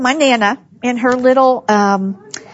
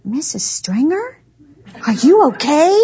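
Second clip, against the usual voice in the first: small, tentative higher voice imitating a worried eavesdropper; little voice; 3 semitones higher than usual. College staffer behind the basement door, imitated little worried voice. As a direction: imitate a timid check-in, rising at the end. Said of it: "I hear this little voice".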